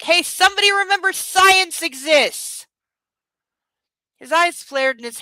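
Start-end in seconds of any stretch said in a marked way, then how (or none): 0.0-2.7 loud, high, fed-up outburst; exasperated shout. Real, not acted. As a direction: yell it at the book in disbelief. Mocking the book's characters.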